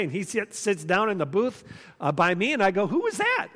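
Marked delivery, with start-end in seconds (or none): 2.9-3.5 high-pitched, incredulous question; baffled question. Retold or imitated. Preacher asks his lunch companion about the stranger, acted bafflement. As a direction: retell it in baffled surprise, pitch leaping up.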